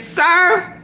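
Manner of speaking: a high, put-on cop voice; lightly voiced officer saying sir; mock police officer. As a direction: play the officer addressing him. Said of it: "The police says".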